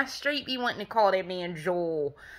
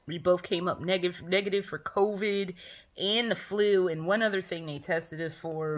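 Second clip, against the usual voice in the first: ordinary talk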